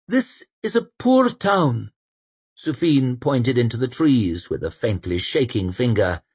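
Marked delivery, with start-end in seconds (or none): 0.1-1.9 put-on high, anxious young man; earnest young man voice. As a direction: press the point, higher and louder. Sufin voiced.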